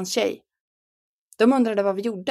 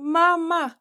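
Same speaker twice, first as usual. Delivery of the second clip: desperate scream; 9 semitones higher than usual. Retold or imitated. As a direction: scream for mom in despair. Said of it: "skrek Lollo". (förtvivlat skrik efter mamma)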